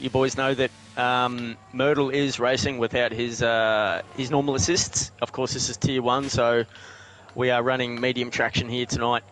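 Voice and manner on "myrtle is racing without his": normal talking voice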